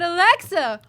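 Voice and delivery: calling to Alexa. Retold or imitated. Talking to the home assistant, calling out loud. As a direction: call out to the speaker device. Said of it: "I said".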